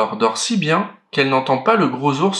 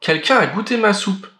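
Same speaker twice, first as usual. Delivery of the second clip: higher mother bear voice; lightly voiced middle bear; the middle bear repeating about her soup, higher; 7 semitones higher than usual. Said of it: "de sa voix moyenne".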